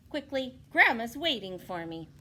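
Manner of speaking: put-on loud, high, hurried girl; a girl rushing off.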